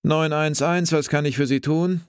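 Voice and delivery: clipped, businesslike call-taker; brusque dispatcher. A narrator's reading. Dispatcher answering the emergency line brusquely. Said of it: "in recht schroffem Ton".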